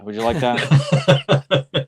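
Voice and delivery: slow, teasing sweet voice; mock tender. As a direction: coo it like to a child. Teasing offer of bedtime trivia.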